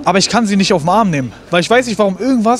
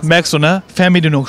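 normal talking voice

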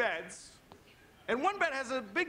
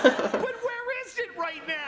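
higher, louder mock indignant rant; hammed-up outrage; 10 semitones higher, 5 dB louder than usual. Comedian ranting at the hotel in hammed-up fury. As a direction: fake fury, laughing through it.

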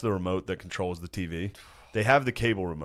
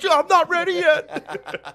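high, drawn-out wail; mock tantrum cry; protesting teen whine-yell; 4 dB louder, much slower than usual. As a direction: holler back at a parent, high and slow. Acted teen yelling back about dinner.

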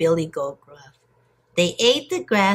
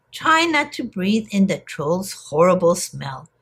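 plain reading voice

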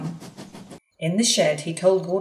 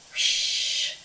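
unhappy steam whoosh; 3 dB quieter than usual. Acted. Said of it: "said Henry unhappily".

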